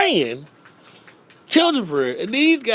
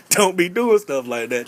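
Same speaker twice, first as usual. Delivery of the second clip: ordinary talk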